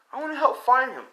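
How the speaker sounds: high, loud acted child plea; eager child. Child to mom wanting to search, pleading.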